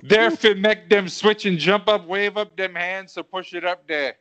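rapped verse in a put-on Jamaican accent; rhythmic amateur Jamaican patois. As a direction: chant it with a mock Jamaican accent. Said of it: "Do I have to do a Jamaican accent?".

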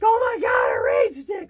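high thrilled squeal; excited shout. Pretend. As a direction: squeal with delight. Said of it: "I'm gonna be like".

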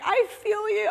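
high, hammed-up teary "I feel you"; mock teary sympathy. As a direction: gush "I feel you" like you're crying at the screen. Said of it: "I'm crying".

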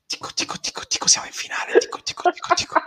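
soft breathy whisper into the mic; ASMR whisper. Pretend. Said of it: "A S M R del respiro per Dennis".

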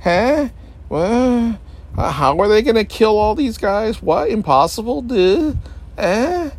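high, exaggerated bafflement; mock confused yelps; a baffled moviegoer mocking the plot; confused viewer. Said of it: "It's like".

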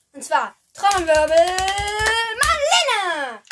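loud build-up to the guest's name; excited announcement. Acted.

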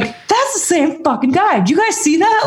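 high-pitched amazed disbelief; incredulous. Retold or imitated. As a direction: squeal it in stunned wonder. Retelling disbelief at a lapping runner.

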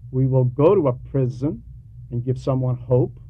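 normal talking voice

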